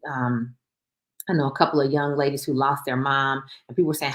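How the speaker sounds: ordinary talk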